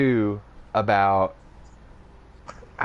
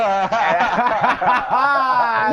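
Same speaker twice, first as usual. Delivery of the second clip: laughing; 13 semitones higher, 7 dB louder than usual. Retold or imitated. Laughs in the imitated voice.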